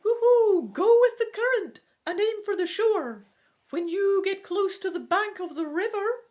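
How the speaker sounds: very high, loud owl squeak; squeaky voice. Acted. Barn owl advising the rowers, squeaky voice. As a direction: hoot and squeak it out loudly. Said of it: "he says in a squeaky voice".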